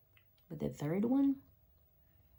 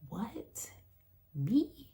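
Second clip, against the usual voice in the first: incredulous what, me; 7 semitones higher than usual. Real, not acted. Disbelief at calling off work.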